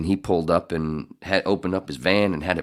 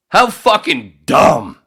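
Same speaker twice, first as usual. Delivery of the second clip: higher, loud, slow disgusted repeat; exasperated; 13 semitones higher, 11 dB louder, much slower than usual. Real, not acted. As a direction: hit it again, even higher. Repeats outburst.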